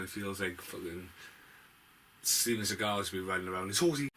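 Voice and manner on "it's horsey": slightly raised, playful 'horsey'; mildly playful. Pretend. Reviewer on Seagal riding around, mildly playful. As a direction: say HORSEY a little higher, still near normal voice. Childlike cry about horse riding.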